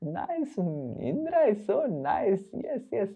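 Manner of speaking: put-on aunt cooing over the helpful boy; pleased mother. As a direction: coo with false delight.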